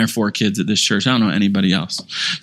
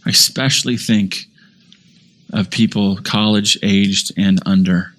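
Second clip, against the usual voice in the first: closing sermon prayer, humble and sincere; 2 semitones lower than usual. Acted. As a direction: pray over the congregation.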